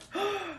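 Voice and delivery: high gasp of delighted surprise; sharp gasp. Real, not acted. Vlogger discovers a rare card, surprised gasp. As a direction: suck in a breath, thrilled. Sees the card she got.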